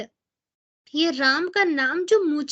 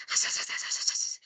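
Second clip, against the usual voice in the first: unvoiced soft "हंस, हंस" hiss, much softer; whispered hiss; 8 dB quieter, faster than usual. Shown as a demonstration. Demonstrating the snake-like chanting hiss once more to listeners. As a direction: breathe the syllables out like a hiss. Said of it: "ऐसे करके".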